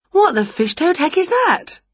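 high, exasperated question; baffled outrage. A narrator's reading. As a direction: squawk it in bleary disbelief. Squinting at unexpected bright sun.

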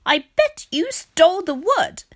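high, loud accusation; accusing. A narrator's reading. Pig blaming Rat for theft, accusing. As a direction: throw the charge at the newcomer.

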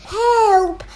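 loud high cry for help; shouted. Acted. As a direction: call out in panic. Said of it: "they all shouted".

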